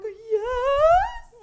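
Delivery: loud, very high, drawn-out squeal of yes; thrilled yes. Retold or imitated. Bartender offered the front bar by the owner, thrilled. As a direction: squeal it like a dream just came true.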